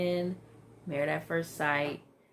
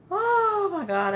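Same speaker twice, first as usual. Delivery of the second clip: much higher, louder, slow exclamation; shocked; 7 dB louder, slower than usual. Real, not acted. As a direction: gasp it out in shock. Shocked by episode ending.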